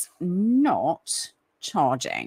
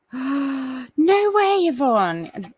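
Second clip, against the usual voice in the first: surprised gasp; 7 semitones higher, much slower than usual. Real, not acted. Surprise at a viewer's age.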